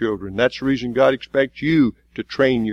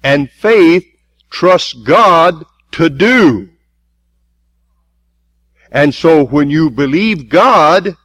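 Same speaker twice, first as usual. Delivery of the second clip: full-force preaching; 4 semitones higher, 6 dB louder than usual. Acted. Preacher driving the congregation toward faith, full force. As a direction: preach it out, hammering each phrase.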